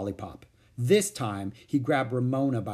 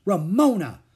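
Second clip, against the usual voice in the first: shocked call; 6 semitones higher, 8 dB louder than usual. A narrator's reading. Sister shocked at Ramona.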